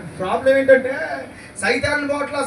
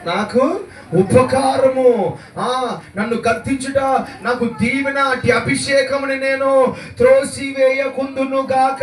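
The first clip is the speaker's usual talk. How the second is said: slow, louder psalm proclamation; fervent recitation; 3 semitones higher, 4 dB louder, slower than usual. Acted. Preacher reciting a psalm to the church, slow and loud. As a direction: declaim the verse slowly with force.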